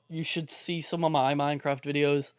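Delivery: normal talking voice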